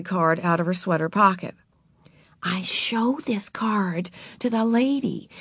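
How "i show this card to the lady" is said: slow whisper. A narrator's reading. Beezus explaining library cards to Ramona, whispered.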